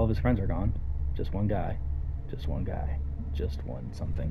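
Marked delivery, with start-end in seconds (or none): 2.3-3.0 just one guy, said much lower and darker; a low, brooding echo of the line; low ominous repeat. Acted. 3.3-4.3 ominous whisper. Acted. Ominous musing after repeated line.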